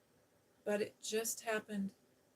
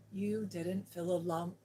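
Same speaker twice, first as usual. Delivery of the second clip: ordinary delivery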